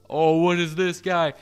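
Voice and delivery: plain reading voice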